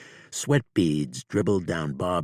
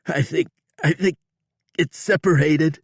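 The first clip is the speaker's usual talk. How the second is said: fast, high, loud stumbling words; rattled outlaw, fast and high; shaken outlaw; 12 semitones higher, 8 dB louder than usual. A narrator's reading. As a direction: gasp it out quickly. Bob gasping after the pain.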